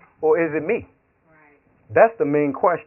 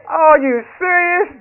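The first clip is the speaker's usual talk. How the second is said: mock disbelief; 10 semitones higher, 7 dB louder, slower than usual. Friend's incredulous reaction.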